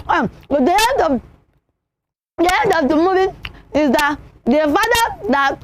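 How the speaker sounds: talking through a mouthful of food; muffled, halting words round food; mouth full. Shown as a demonstration. Teacher showing children how not to talk at table, mouth full. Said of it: "you don't talk with your mouth full".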